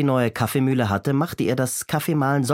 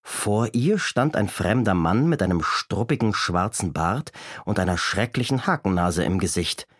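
usual speaking voice

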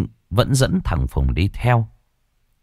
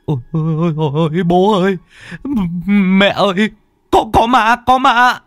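screaming; 12 semitones higher than usual. A narrator's reading. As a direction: scream for mom and dad in terror. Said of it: "Phùng hét toáng lên".

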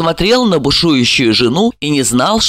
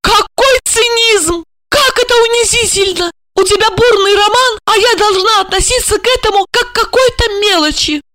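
very high-pitched hysterical wife; indignant outburst. A narrator's reading. Wife accusing husband of affair, indignant outburst. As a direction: rage in a high, wounded voice.

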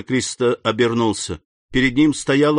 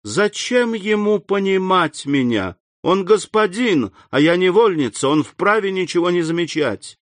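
heart-rending; 7 semitones higher than usual. A narrator's reading. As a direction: let the hurt tear through. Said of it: "душераздирающим голосом".

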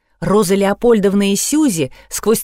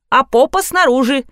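ordinary delivery, nothing marked

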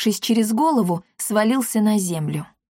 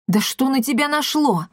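raised-pitch indignant exclamation; 5 semitones higher than usual. A narrator's reading. Edvin to the pilot swinging a pipe, indignant. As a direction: protest in disbelief at the attack. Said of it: "негодующе воскликнул Эдвин".

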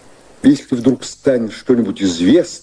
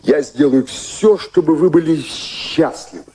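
higher, slow, tender reassurance; slow soothing promise to a worried woman; gentle reassurance; 7 semitones higher than usual. Acted. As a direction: soothe her slowly, voice lifted. Reassuring distressed Mary.

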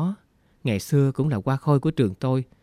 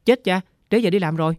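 sudden high, louder alarmed cry; panicked cry; 7 semitones higher, 4 dB louder than usual. A narrator's reading. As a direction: blurt it out in a panic, as if late for work. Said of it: "hoảng hốt kêu".

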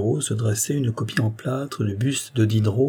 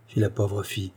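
usual speaking voice

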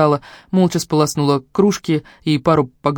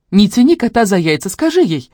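louder, higher impatient push; urging; 6 semitones higher, 5 dB louder than usual. A narrator's reading. Sister urging brother to confess, impatient. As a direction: prod him to act now.

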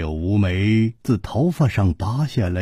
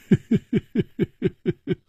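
light, breathy laugh; a rueful little laugh; 12 semitones higher than usual. Acted. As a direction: laugh gently to comfort a friend. Hu Tiehua laughs before tender words.

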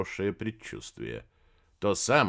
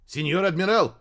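raised, louder urgent call; cried out; 6 semitones higher, 5 dB louder than usual. A narrator's reading. Radio operator running into the tent, crying out. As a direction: burst in calling out. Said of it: "закричал вбежавший в палатку радист".

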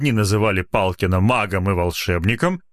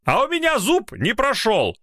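angry shout; 14 semitones higher than usual. Acted. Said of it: "зло выкрикнул".

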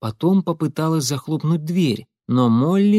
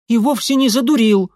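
higher, louder angry protest; young lady defending her lover, angry; angry retort; 10 semitones higher, 6 dB louder than usual. A narrator's reading. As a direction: snap back, offended. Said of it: "рассердилась Нэнси".

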